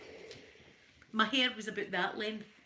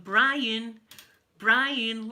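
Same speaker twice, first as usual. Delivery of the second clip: loud imitation of a call across the room; louder, mimicking someone calling a customer; calling out a name; 9 dB louder than usual. Restaurant girl calls out a pizza order, calling out. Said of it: "like that".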